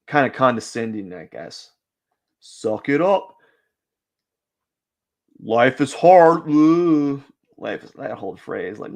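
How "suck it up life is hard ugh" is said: mocking tone. Mocking the suck-it-up advice.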